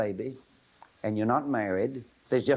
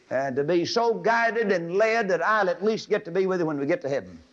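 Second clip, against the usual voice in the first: ordinary delivery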